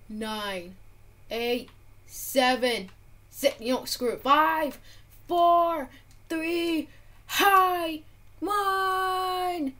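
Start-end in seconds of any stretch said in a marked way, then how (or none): 4.2-9.7 high, loud excited count; excited countdown. Real, not acted. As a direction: shout the last seconds. Counting down the last seconds.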